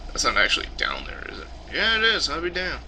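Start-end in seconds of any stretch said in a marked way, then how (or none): 1.7-2.8 high, astonished realization; surprised. Real, not acted. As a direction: sound amazed it's really there. Surprised discovery.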